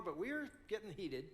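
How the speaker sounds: ordinary talk, nothing marked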